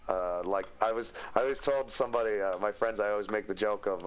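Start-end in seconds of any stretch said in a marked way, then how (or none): none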